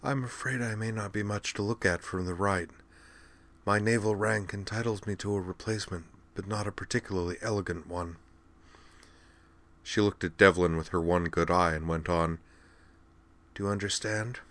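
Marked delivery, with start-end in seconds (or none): 0.0-8.2 soft, dry invalid's voice; hushed, dry rasp; raspy. A narrator's reading. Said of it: "Her voice was raspy, dry from oxygen". 13.5-14.4 soft, dry invalid's voice; hushed, dry rasp; raspy. A narrator's reading. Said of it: "Her voice was raspy, dry from oxygen".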